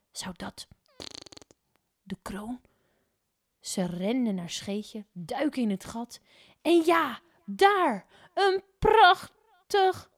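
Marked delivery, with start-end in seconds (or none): 0.1-2.6 soft whispered wonder; hushed. A narrator's reading. Mevrouw Poepies to the neighbour, soft hopeful whisper. 6.6-10.1 high, loud, slowed; excited build. A narrator's reading. Narrator building up to the find, high loud and slow. Expecting the crown.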